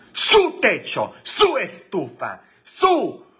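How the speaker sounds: high, slow falsetto nagging; hammed-up old maid voice, high and drawn out; mock maid's complaint. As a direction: whine out each item like the nagging maid. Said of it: "esa vieja siempre venía y le decía".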